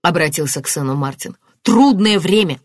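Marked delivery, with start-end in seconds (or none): 1.6-2.6 louder, higher scornful exclamation; indignant echo. A narrator's reading. Martin throwing the detective's words back, indignant.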